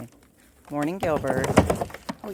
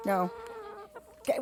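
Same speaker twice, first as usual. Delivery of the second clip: ordinary talk, nothing marked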